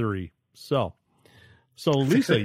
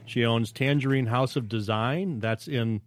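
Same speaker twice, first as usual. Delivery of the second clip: ordinary talk, nothing marked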